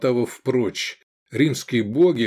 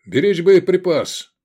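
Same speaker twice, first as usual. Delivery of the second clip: firm, raised order; loud, high military command; 7 semitones higher, 5 dB louder than usual. A narrator's reading. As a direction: call it out as a commander. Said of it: "скомандовал Лобанов".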